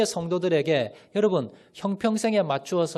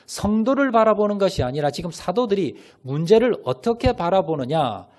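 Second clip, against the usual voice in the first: ordinary talk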